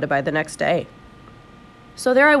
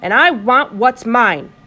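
loud, slow, defiant demand; 4 semitones higher, 7 dB louder, slower than usual. A narrator's reading. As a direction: demand it loudly, leaning on each word. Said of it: "I said in a loud voice".

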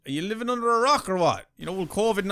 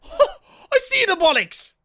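very high, slow laughing shriek; laugh-scream it out in nervous terror; panicked laughing scream; 5 dB louder, much slower than usual. Real, not acted. Gamer sees the monster up close, laughing shriek.

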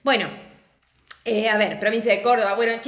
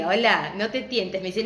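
usual speaking voice

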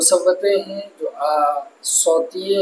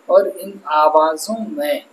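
ordinary talk, nothing marked

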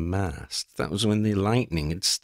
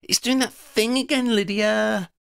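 high and loud, bursting with news; excited exclaim; 12 semitones higher, 4 dB louder than usual. Acted. Freddy calls to Lydia about the mandala, excited high call. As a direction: blurt it out breathless with excitement. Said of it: "Freddy exclaimed".

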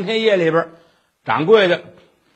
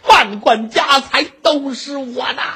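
high, crowing triumph breaking into a long laugh; hammed-up greedy glee; gloating laughter; 6 semitones higher than usual. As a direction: gloat over the riches, then cackle. Schemer gloats over imagined fortune.

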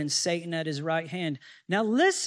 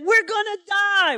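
much higher and louder, in panicked alarm; alarmed cry; 12 semitones higher, 6 dB louder than usual. Acted. Manoah tells his wife after the angel vanishes, alarmed cry. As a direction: blurt it out in sudden dread.

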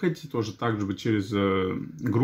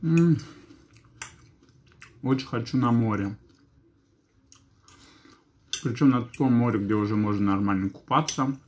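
chewing between words; eating salad while talking; mouth full of food. Real, not acted. Seen 1: Forkful of salad taken at 2.6, talks while chewing throughout.

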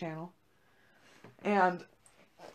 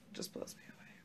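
hushed, breathless whisper; whispered in disbelief; 18 dB quieter than usual. Real, not acted. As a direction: drop to a voiceless hush in awe. Repeated phrase, hushed.

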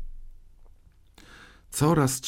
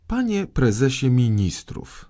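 higher, indignant cutting-in; sharp interruption; 5 semitones higher, 4 dB louder than usual. A narrator's reading. Boy king interrupting his prime minister, indignant. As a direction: cut the minister off, higher and insistent. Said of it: "przerwał Maciuś".

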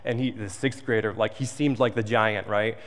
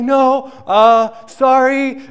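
much higher and louder, squeaky apology; a frightened boy blurting sorry to a bully; scared little kid; 11 semitones higher, 10 dB louder, much slower than usual. Retold or imitated. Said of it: "I'm like".

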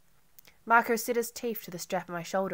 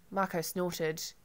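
ordinary delivery, nothing marked

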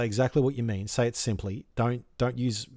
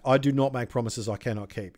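normal talking voice

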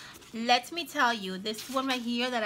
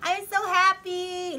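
thrilled squeal; 13 semitones higher, 5 dB louder than usual. Real, not acted. Thrift shopper squeals at a perfect Christmas card, high and delighted. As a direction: squeal it like you just found treasure.

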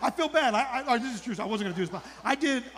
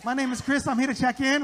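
higher-pitched, drawn-out flamboyant greeting; 5 semitones higher than usual. Acted. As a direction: play up a camp check-in voice.

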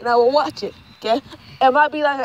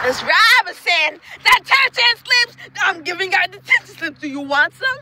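hammed-up teacher call; mock teacher; 4 semitones higher than usual. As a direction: imitate a teacher calling out, pitched up. Acts out teacher giving detentions.

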